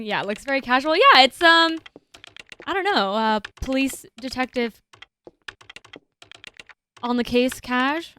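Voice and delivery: usual speaking voice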